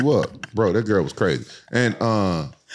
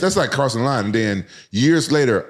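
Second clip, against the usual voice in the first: ordinary talk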